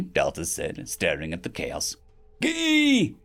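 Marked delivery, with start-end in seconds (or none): 2.4-3.2 high, sharp yelp of excitement; excited yell. Acted. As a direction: shout it out with glee. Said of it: "someone yelled".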